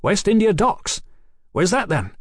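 high, excited questioning; flushed with excitement. A narrator's reading. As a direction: jump in eagerly with a question. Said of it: "with a flush of excitement".

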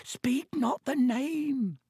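very high, slow wail of dread; moaning. A narrator's reading. Elf covers his ears at the dreaded name, moaning. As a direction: moan it in a drawn-out elf squeal. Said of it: "and moaned".

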